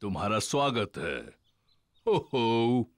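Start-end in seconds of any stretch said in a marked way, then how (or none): 2.1-2.9 loud jolly chuckle. Acted. Daddy Pig chuckles heartily at the ducks. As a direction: give a hearty ho ho.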